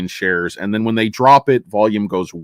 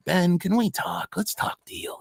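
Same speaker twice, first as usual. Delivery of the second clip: hushed, slower lawyer voice, fading out; softly coax, mock courtroom drama; imitated defense attorney; 7 semitones higher, 8 dB quieter than usual. Defense attorney asks prosecutor for a deal, hushed mock plea. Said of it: "He's like".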